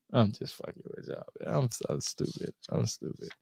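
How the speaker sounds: soft, breathless whisper after laughing at his own joke; barely voiced, giggly aside calling himself stupid; whispered self-mockery. Real, not acted. Host laughing at his menu pun, whispered to viewers.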